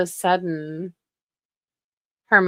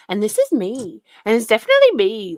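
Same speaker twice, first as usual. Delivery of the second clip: louder, fast mock voice; mocking imitation; 3 semitones higher, 6 dB louder, faster than usual. Mocking the streamer who insists it's really her. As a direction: rattle it off in her put-on voice. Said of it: "who is imitating you".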